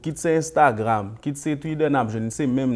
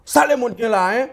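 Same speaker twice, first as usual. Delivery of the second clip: mock angry; 11 semitones higher, 4 dB louder than usual. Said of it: "Vous allez dire, on est qui fâché".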